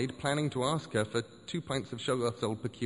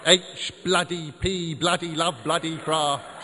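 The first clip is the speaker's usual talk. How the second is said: louder and higher, every bloody spat out slowly; slow loud rant; 5 semitones higher, 7 dB louder, slower than usual. Acted. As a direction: hammer each syllable out in a rage. Ranting about Lovecraft.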